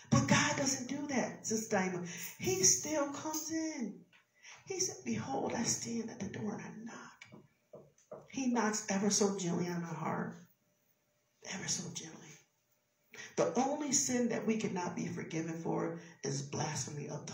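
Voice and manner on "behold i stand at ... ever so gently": soft, hushed near-whisper; gentle, hushed. Acted. Acting God's gentle knock at heart.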